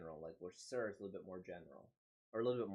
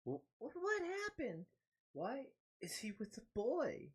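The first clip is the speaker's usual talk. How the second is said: shocked gasp. Acted. Shocked manga character reading.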